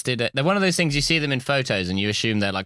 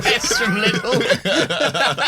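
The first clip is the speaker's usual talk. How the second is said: laughing; 10 semitones higher, 3 dB louder, much slower than usual. Real, not acted. Laughing through a joke.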